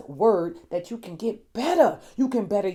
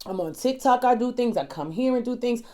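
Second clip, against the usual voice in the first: ordinary talk, nothing marked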